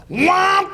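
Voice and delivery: high comic "womp"; sudden blurted noise. Retold or imitated. Retells breaking the writers' room silence, high sudden womp. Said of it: "I'll just go".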